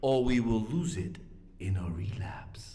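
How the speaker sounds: dramatic hushed whisper. Acted. Speaker warning the group, in a whisper.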